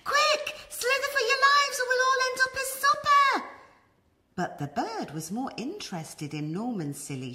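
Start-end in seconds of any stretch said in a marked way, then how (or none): 0.0-3.5 fast, high, loud squeaky panic; panicked snails. Acted. Snails urge each other to flee, fast high panic. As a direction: rush them to flee for their lives.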